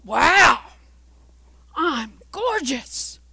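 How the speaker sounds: loud, bursting wow; amazed. Acted. Teacup seeing itself in the mirror, amazed.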